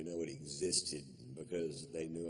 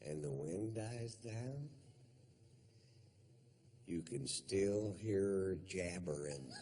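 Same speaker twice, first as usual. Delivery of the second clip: slow spooky legend. Pretend.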